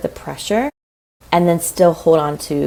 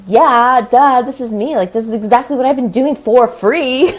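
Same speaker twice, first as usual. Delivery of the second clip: a higher, louder, playful 'duh, that's me'; eager duh; 5 semitones higher, 5 dB louder than usual. Retold or imitated. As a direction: toss it off like it's obvious, raised and bright. Retelling her own eager reaction.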